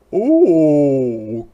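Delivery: loud, surprised goat oh; delighted goat exclamation; goat cry. Acted. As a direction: bleat it out in surprise. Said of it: "cried Goat".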